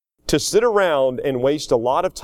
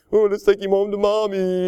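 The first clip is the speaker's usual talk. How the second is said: put-on higher, babying taunt; mocking teasing; 4 semitones higher than usual. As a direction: imitate workmates making fun of a sick kid. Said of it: "they all started laughing at me".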